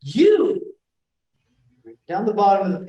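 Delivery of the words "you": "You." said much higher and louder; stressed you. Shown as a demonstration. Teacher demonstrates a pronoun in stressed position, punched. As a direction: fire "You." out at her. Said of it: "I know that I'm gonna say".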